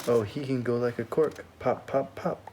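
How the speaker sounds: usual speaking voice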